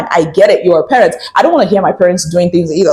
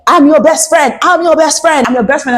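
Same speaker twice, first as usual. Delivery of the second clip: indignant mother; 7 semitones higher than usual. Retold or imitated. Scolding mother voiced, retold.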